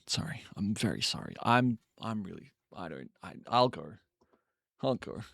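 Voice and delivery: quietly sorry, fading out; embarrassed fade. Real, not acted. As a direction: shrink away, apologizing softly. Embarrassed apology fading away.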